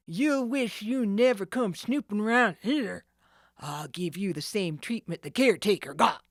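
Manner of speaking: loud rough threat; menacing villain. Acted. The ringleader threatens Nancy, loud rough villain. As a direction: promise her the worst.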